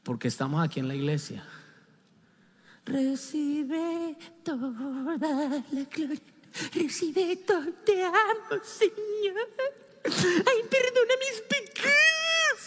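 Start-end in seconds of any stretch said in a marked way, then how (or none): 2.9-12.6 high, slow, overly tearful praise; hammed-up devout wailing; mock pious worshipper. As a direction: ham up a showy churchgoer's prayer. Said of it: "no diferimos en nada de este señor".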